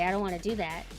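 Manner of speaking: normal talking voice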